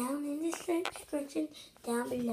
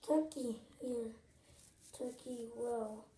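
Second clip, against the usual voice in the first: ordinary talk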